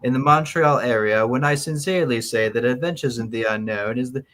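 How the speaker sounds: mannered, British-sounding fifties letter writer; nineteen-fifties voice. Acted. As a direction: read it out in your best old-time fifties voice. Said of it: "in your best nineteen fifties voice".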